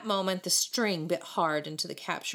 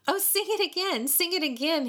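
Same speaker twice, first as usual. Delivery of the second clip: high, delighted plea; crowing; 11 semitones higher, 3 dB louder than usual. A narrator's reading. David enjoying the boys' chant, crowing. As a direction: crow it with innocent delight. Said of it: "he crowed".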